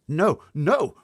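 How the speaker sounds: high loud denial; BFG crying out. Acted. BFG denying to the giant, crying out. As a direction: cry no in alarm. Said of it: "cried the B F G".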